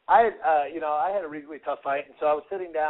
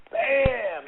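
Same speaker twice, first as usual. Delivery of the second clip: punch sound effect; 9 semitones higher than usual. Retold or imitated. Fight story punch sound.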